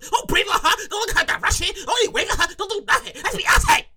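high, rabid defence of an idol; rapid-fire threats from an outraged superfan; hammed-up indignant fan; fanatical fan. Acting out a fierce [name] fan.

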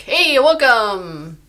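bright, drawn-out host welcome; chirpy and upbeat, slowly; perky greeting. Pretend. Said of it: "I should be super perked up".

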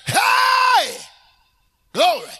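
long high yell, then a punched cry; shouting Jesus. Acted. Preacher quoting Jesus crying out, high ringing shout. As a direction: let it ring out at full volume. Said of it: "He said".

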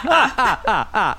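high mock vampire cackle; lightly voiced counting vampire laugh; Count Chocula laugh. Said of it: "now I'm Count Chocula".

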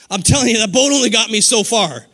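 ordinary talk

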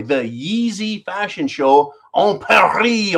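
hammed-up French pronunciation of "en Paris"; mock French accent. Host showing off high-school French, mock French accent. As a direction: say "Paris" the French way, "Paree", drawn out.

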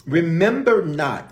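each word weighted, slow; slow emphatic quote. Shown as a demonstration. Preacher slowly quoting scripture to the congregation, weighted. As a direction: lay it down slowly. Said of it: "I want to just break this verse down".

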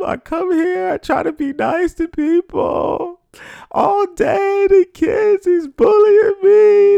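sobbing and wailing, pitched far up; hammed-up hysterical sob story, voice cracking high; hysterical crying. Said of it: "I literally start crying hysterically".

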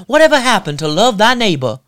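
hint of a Southern church lilt; mock Southern accent. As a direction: lay on a fake Southern accent, sing-song. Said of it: "they get a feigned Southern accent".

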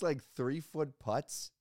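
ordinary delivery

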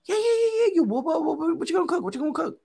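high, stammering, tripping babble; mad nervous. Retold or imitated. Nervous boyfriend offered food, mad nervous. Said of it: "acting mad nervous".

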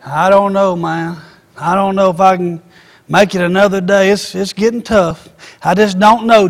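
acted low, subdued defeat; discouraged reply. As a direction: play someone close to giving up. Acting a discouraged person's answer.

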